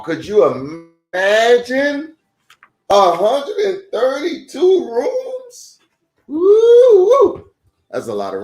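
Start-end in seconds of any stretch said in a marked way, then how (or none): 0.0-5.8 high, slow wonder; awed, drawn-out question; amazed. Real, not acted. Amazed aside to kids. 6.3-7.5 high, loud woo-woo; delighted whoops; excited whoop. Real, not acted. Excited cheer to kids.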